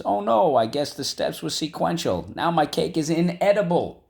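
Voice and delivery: high, bright exclaiming baker; silly character voice. Acted. Teacher reading the example sentence in a high dismayed voice.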